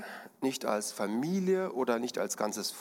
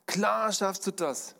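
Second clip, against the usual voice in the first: higher voiced crowd cheer; calling out; 9 semitones higher than usual. Crowd shouts encouragement to the artist, higher. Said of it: "riefen die einen".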